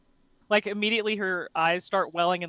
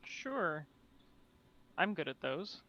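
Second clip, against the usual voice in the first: soft, dry sarcasm; sarcastic; 2 semitones lower, 13 dB quieter, slower than usual. Real, not acted. Player asked for a medicine check, softly sarcastic. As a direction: say it quietly, with no real confidence behind it. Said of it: "I say sarcastically 'cause I'm not".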